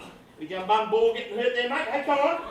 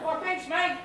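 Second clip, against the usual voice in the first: ordinary delivery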